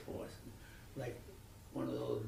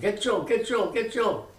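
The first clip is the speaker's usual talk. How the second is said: 1 father yelling; 12 semitones higher, 11 dB louder than usual. Retold or imitated. Father calls for son urgently.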